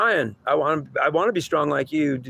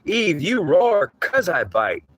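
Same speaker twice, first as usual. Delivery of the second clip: slow emphasis; 4 semitones higher, much slower than usual. Real, not acted. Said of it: "slow emphatic teaching line".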